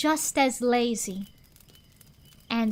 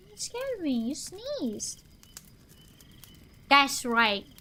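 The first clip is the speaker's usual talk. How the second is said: usual speaking voice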